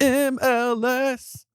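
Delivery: announcer blip. Pretend. Jokey MLS audio blip.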